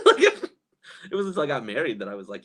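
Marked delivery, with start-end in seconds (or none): 0.1-0.4 high laughing burst. Real, not acted. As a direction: laugh through the word.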